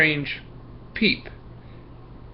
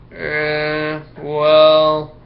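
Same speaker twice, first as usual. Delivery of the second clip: drawn-out uneasy well, louder; squirming hesitation; 3 semitones higher, 5 dB louder than usual. Acted. Marco caught in his fib, squirming. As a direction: squirm out of answering. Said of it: "with sort of a squirm".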